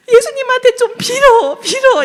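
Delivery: put-on high, loud, near-crying child's begging; desperate plea. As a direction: plead as if about to cry. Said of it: "유한이가 되게 절박하게".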